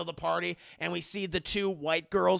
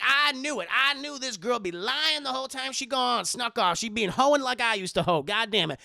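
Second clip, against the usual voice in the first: worked-up, loud, higher-pitched rant; retold angry outburst; 4 semitones higher, 5 dB louder than usual. Madea finds the girl snuck off, mock outrage. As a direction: rant it indignantly, hammed up for the retelling.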